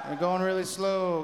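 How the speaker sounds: usual speaking voice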